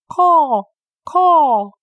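high, loud cawing bird screech; shrill screeching crow caw. Acted. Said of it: "sang out".

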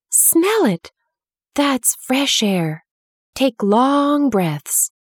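high and slow, savouring each word; lightly voiced girl, thrilled and urging; excited urging. A narrator's reading. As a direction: coax him to breathe in the spring. Mary flings the window open.

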